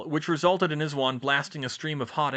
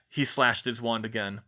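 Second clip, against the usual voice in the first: plain reading voice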